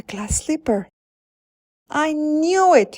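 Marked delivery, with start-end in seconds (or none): 1.9-3.0 high, loud and slow with delight; cried out. A narrator's reading. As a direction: burst out in joy. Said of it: "he cried".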